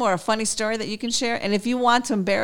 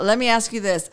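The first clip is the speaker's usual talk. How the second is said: ordinary talk, nothing marked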